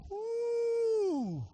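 a loud, high nervous whoo; high woo. Real, not acted. Preacher on a touchy topic, whooping loud and high. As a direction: let out a loud, high woo as if stepping on thin ice. Joke about marriage topic.

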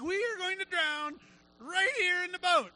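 high-pitched retold freak-out; mock panic. Disciples in a storm-tossed boat, mock panicking. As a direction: wail it high, hammed-up doom. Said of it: "they are freaking out".

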